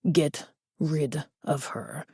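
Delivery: put-on slow, soft, menacing Spanish-accented order. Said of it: "A heavy Spanish accent".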